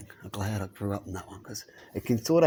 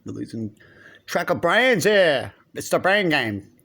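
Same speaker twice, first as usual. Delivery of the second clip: usual speaking voice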